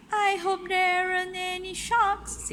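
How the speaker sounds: high, bright lady's voice; Missus Armitage voice. A narrator's reading. Parent reading aloud, voicing Missus Armitage, high and prim. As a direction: lift the voice up for the lady. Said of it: "said Missus Armitage".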